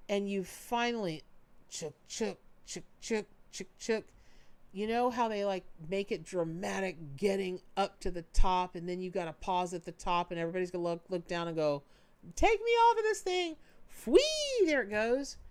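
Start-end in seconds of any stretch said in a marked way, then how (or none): 1.7-4.0 coaster clacking noise. Retold or imitated. Roller coaster climbing noise. 12.3-15.4 much higher, loud panicked squeal into a whee; scared coaster rider. Retold or imitated. Coaster rider at the top, squealing in mock panic.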